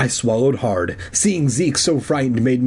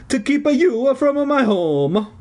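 high, slow stage-Italian; Italian accent; 13 semitones higher, slower than usual. A narrator's reading. Phantom explaining why he scared them, slow Italian accent. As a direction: stretch it out in hammed-up Italian. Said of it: "his hoarse Italian voice".

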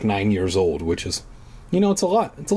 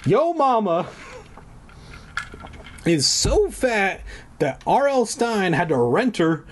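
mock insult voice; 7 semitones higher than usual. Yo mama joke.

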